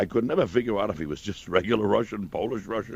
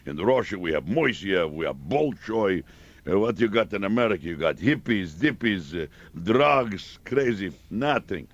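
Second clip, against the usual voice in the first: a light Russian scoffing at America; Russian accent; 2 semitones lower than usual. Retold or imitated. Russian mocking American culture to Kissinger, light accent.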